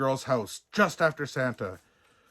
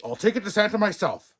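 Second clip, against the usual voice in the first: determined; 7 semitones higher, 4 dB louder, faster than usual. A narrator's reading. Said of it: "said the postmaster".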